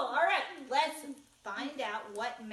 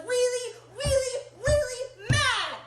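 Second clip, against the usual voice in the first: each really higher and louder, slowing on mad; building emphasis; 12 semitones higher, 11 dB louder, slower than usual. Acted. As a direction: climb up to the biggest mad. Building up Mouse's anger.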